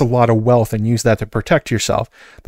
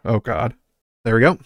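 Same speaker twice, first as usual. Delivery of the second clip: ordinary delivery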